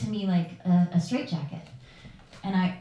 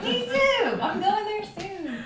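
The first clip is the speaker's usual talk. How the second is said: high, laughing reply; laughing at her own humoring of dad; laughing along; 12 semitones higher, slower than usual. Real, not acted. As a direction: play along while giggling.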